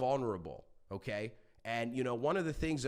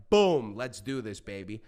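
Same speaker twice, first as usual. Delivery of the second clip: excited quote; 7 dB louder than usual. Retold or imitated. Host retelling how his podcast took off, excited. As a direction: hit it loud, like kicking things off. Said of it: "I was just like".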